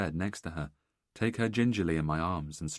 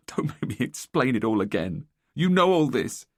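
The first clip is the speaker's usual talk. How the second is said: distressed plea; 5 semitones higher, 8 dB louder than usual. Acted. Wife upset, pleading.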